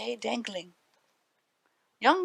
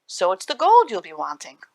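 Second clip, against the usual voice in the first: sharp knowing accusation, louder; 2 semitones lower, 8 dB louder than usual. A narrator's reading. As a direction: catch him out sharply. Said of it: "declared the leprechaun sharply".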